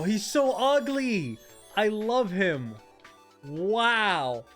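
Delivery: loud, high, slow gush; excited. Real, not acted. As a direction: gush with delight. Shiny Pokémon appears.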